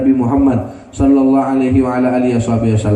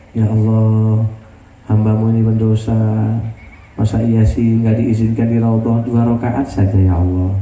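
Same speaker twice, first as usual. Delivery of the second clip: intimate prayer; 5 semitones lower than usual. Acted. Tender prayer to Allah.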